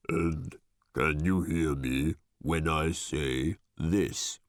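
very low, slow, tomb-like voice; sepulchrally deep voice. A narrator's reading. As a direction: drop it deep and slow, like from a crypt. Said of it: "this time in a sepulchrally deep voice".

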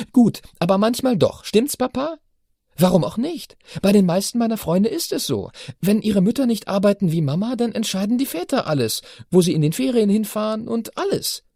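put-on high, insistent girl's voice; teenage daughter, indignant. A narrator's reading. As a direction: argue like a righteous teen. Chiara argues.

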